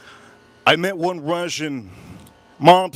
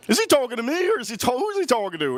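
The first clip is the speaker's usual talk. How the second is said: baffled disbelief; 9 semitones higher, faster than usual. Retold or imitated. Retold disbelief about the stranger.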